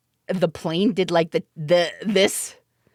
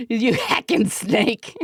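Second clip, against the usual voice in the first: mock stewardess voice; 3 semitones higher, slower than usual. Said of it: "stewardess voice acting".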